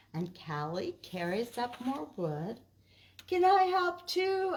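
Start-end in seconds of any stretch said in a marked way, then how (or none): 3.3-4.5 much higher, louder eager voice; high, loud eager question; Marty asking. Acted. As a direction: beg to join in. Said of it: "asks Marty".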